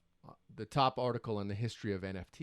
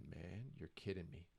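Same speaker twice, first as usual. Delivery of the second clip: deflated mutter; 6 semitones lower, 16 dB quieter than usual. Retold or imitated.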